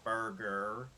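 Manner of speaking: burger said in an American accent, with hard R's; American accent, roll the R in burger. Shown as a demonstration. Learning an American accent with a friend, burger. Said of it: "I can say".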